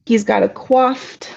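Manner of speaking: ordinary talk, nothing marked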